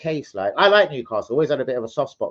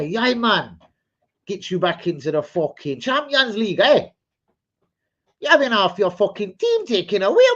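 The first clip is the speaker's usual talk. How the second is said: mock Geordie; 6 semitones higher, 4 dB louder than usual. Continues Geordie impression.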